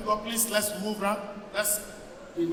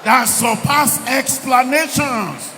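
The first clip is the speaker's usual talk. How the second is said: shouted prayer declaration; 7 dB louder than usual. Real, not acted. Preacher leads the congregation in prayer, shouted. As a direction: proclaim it forcefully, then repeat insistently.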